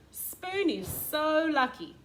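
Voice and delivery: high, loud, slow envious sigh; Knife voice. Acted. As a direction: play Knife wishing he were Spoon.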